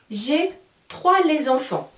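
J'ai trois les enfants with a wrong les before enfants; learner's mistake, trois les enfants; common mistake. Shown as a demonstration. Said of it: "plusieurs d'entre vous font cette erreur".

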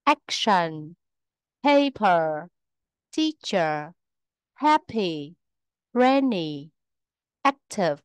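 each word starts strong and falls away on its second syllable; first-syllable stress. Shown as a demonstration. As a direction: stress the first syllable, as in ACtion, PAper, TEAcher. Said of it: "vào âm tiết thứ nhất".

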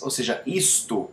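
ordinary talk

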